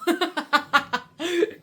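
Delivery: a burst of loud, high laughter; real laugh. Real, not acted. Vlogger cracking up at her own story, loud real laugh.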